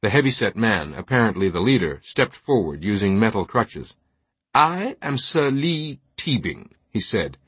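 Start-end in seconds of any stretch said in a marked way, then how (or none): 4.5-6.7 slow, lofty upper-crust British introduction; highbrow British accent. A narrator's reading. Knight introducing himself to altar boy, highbrow British accent. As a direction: announce yourself in higher, highbrow British tones. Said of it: "his accent a highbrow Saxonesque British".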